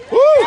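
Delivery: high, loud whoop of joy; excited cheer. Real, not acted. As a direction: cheer the crowd on. Rally speaker cheering the crowd.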